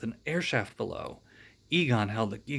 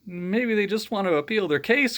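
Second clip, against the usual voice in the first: wisecrack; 8 semitones higher, 4 dB louder than usual. Acted. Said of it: "cracked Peter".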